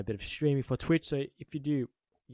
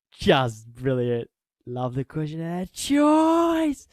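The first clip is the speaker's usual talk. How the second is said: slow savouring; 2 semitones higher, 7 dB louder, much slower than usual. Real, not acted. Savouring a comment.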